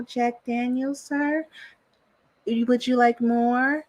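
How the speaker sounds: hushed, doting mock wife waiting on her husband; submissive wife. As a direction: purr like a doting wife serving her king. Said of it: "his wife was serving him".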